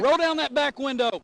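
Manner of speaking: loud high commanding yell; shouting. Real, not acted. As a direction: yell it at the car. Trooper ordering suspects.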